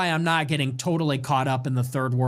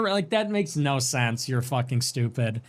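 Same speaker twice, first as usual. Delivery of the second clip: ordinary delivery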